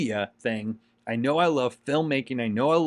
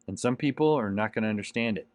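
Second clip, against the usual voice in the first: normal talking voice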